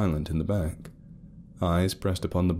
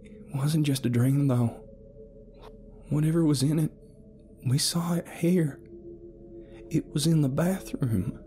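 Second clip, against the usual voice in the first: higher, uncertain explaining; Carol explaining; 10 semitones higher than usual. A narrator's reading. Carol telling Felton about the shared dream, unsure. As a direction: search for the words. Carol continues her account.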